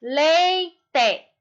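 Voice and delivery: "Leite" split into a long, loud LEI, then TE; separated syllables. Shown as a demonstration. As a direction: stretch the first syllable of "Leite" and detach the second. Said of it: "separar as sílabas".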